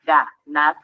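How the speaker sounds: "ganar" en dos golpes, ga y nar, más grave; separado en sílabas. Shown as a demonstration. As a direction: separa "ganar" con pausa entre sílabas. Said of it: "Al separarla en sílabas". ("ganar" silabeado para enseñar)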